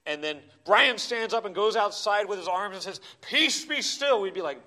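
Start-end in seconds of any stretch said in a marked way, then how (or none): 3.2-4.2 1 mock command. Brian commanding the thunderstorm.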